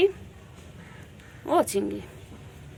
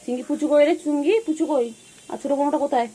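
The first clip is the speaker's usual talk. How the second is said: high sing-song calling; 8 semitones higher, faster than usual. Real, not acted. Owner looking for the pets, high sing-song calling. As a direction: sing out the pet's name happily.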